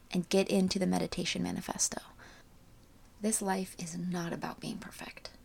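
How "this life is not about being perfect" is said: soft hushed spiritual voice; quiet and airy, almost a whisper; airy breathy mystical healer. Acted. Closing soul message.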